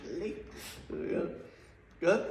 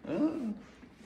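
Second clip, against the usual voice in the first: laughing; 6 semitones higher than usual. Real, not acted. Laughs at own joke.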